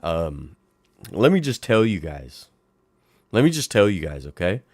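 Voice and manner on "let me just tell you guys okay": ordinary talk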